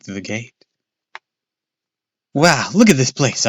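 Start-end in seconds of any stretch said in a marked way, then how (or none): 2.3-3.5 higher, louder wow; excited cry. Acted. Boy marveling at the horror park. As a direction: gasp at the place. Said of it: "I cried".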